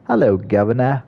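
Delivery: lightly put-on British accent; slightly amateur British accent; British impression. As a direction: toss out a hammy British greeting. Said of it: "that's my British impression".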